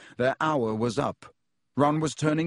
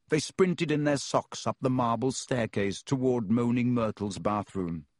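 usual speaking voice

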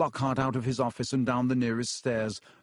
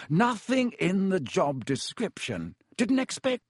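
Lockhart's voice, a touch higher and slower; lightly voiced vain wizard, mumbling; a hint of a fussy, evasive wizard; muttered; 7 semitones higher than usual. A narrator's reading. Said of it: "Lockhart muttered".

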